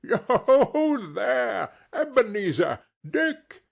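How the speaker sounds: rich, oily, hearty call, high and loud, laughing; a fat merchant calling his lads with a laugh; jovial fat voice. A narrator's reading. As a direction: Fezziwig calling the apprentices in a jovial voice. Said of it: "called out in a comfortable, oily, rich, fat, jovial voice".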